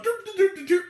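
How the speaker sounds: high, sing-song "doop" beeps; mimicked dialing sounds. Critic mimics trying to call her, high mock beeping. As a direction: imitate phone beeps in a high voice. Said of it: "they're going like".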